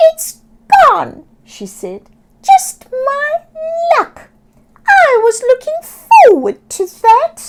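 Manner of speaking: high, loud squawky seagull, disappointed. Acted. Seagull Sammy losing her crab, high squawking lament. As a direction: squawk it like a bird who dropped her lunch.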